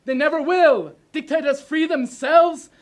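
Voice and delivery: exploding, loud, high-pitched oration; ranting dictator speech. Acted. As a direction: burst out with everything left. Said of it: "do it like you're just exploding".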